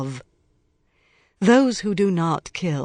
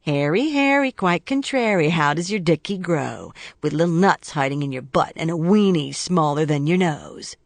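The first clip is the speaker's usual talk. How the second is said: mocking rhyme; 3 dB louder than usual. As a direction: taunt him like a schoolyard bully. Mocking nursery rhyme taunt.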